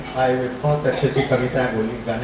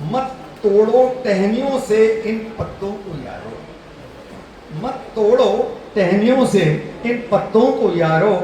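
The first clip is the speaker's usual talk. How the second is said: higher, emphatic verse declamation; poem recital; 5 semitones higher, slower than usual. Real, not acted. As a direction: declaim verse with ringing openings and falling closing lines.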